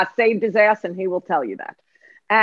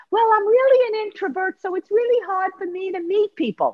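much higher, whiny excuse; 11 semitones higher than usual. Retold or imitated. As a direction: whine out the excuse up high. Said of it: "That would be a weather report".

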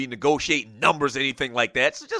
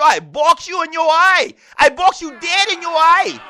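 boastful Geechee accent, much higher and louder; 11 semitones higher, 4 dB louder than usual. Shown as a demonstration. Host hams up a Geechee boast for listeners, loud Geechee accent. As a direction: brag and threaten playfully in a thick Geechee accent. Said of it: "the Geechee accent is kinda like".

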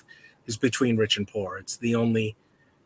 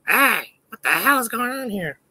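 high, startled voice for the dog; startled dog voiced high; dog's inner voice; 12 semitones higher, 5 dB louder than usual. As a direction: voice the dog's confused thoughts. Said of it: "He's like".

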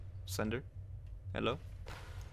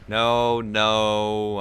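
usual speaking voice

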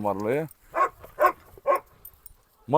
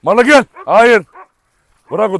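sharp shout; 6 semitones higher, 10 dB louder than usual. Real, not acted. Shouting at dog with ball.

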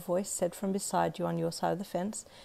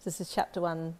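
usual speaking voice

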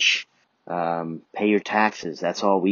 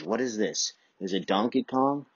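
ordinary talk, nothing marked

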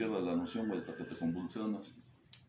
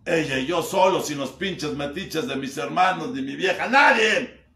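loud, higher cry of longing; emotional outburst; 6 semitones higher, 11 dB louder, slower than usual. Real, not acted. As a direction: build to a raw outcry. Sueño de cena con su madre. (arrebato fuerte y alto de añoranza)

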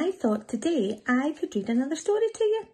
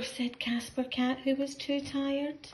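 ordinary delivery

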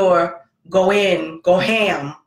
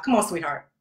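put-on soft, lower sweet coaxing to a child; forced sweetness; 3 semitones lower, 3 dB quieter than usual. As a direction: coax a kid you'd rather yell at. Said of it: "he's somebody else's child".

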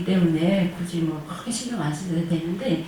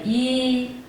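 usual speaking voice

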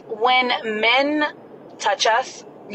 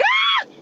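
very high short scream; mock scream. Woman demonstrating screaming at men in bars, high scream. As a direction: let out a sudden high scream. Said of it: "just scream from there and be like".

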